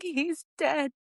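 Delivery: high, strained voice with a broken nervous laugh; stunned disbelief. Retold or imitated. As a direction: say it as if you can't believe it yourself. Telling friend son is dead.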